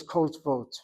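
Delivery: "kot vot" run as two syllables, no vowel between t and v; everyday Israeli pronunciation. Shown as a demonstration. Teacher quoting how every Israeli says it, two syllables. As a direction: close "kot" on the t and go straight into "vot". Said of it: "Every Israeli says".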